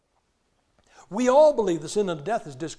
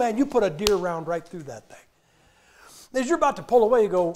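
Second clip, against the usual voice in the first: normal talking voice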